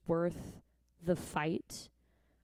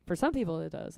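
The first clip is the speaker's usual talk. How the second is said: ordinary talk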